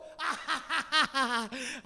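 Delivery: loud, rolling ha-ha-ha; loud belly laugh. Real, not acted. Preacher laughing at being called stupid, loud belly laugh. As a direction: burst out laughing at yourself. Laughs at his own story.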